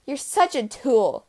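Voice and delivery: mock yell. A narrator's reading. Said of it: "One yelled".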